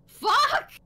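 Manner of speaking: 1 shocked outburst. Real, not acted. Reacting to shocking creepypasta line.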